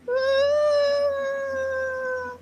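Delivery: long high-pitched squeal of laughter. Real, not acted. Host cracking up at his own story, squealing laugh. Laughing after the retold yell.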